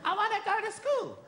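put-on high child's voice; eager small child. Son to sleepy father at night, high childish. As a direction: pipe up high like a little boy.

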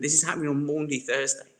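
usual speaking voice

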